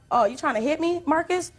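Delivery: high, rising challenge at normal volume; retold challenge. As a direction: act out confronting an ex mid-quarrel. Retold challenge to ex during fight.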